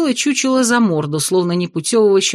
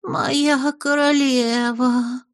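higher, very slow breathy awe; breathless murmur; 6 semitones higher, much slower than usual. Acted. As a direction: breathe it out in adoration. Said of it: "пробормотал с придыханием".

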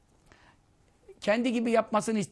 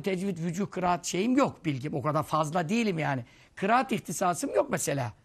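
ordinary talk, nothing marked

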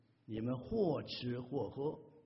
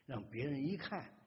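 prayer; 4 semitones lower than usual. Acted. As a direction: pray humbly for the church. Leads closing prayer.

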